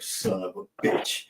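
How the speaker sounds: slow, whispered retold curse; hushed, drawn-out swearing; under-breath curse. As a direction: curse softly to yourself, drawn out. Retells own reaction, cursing to himself.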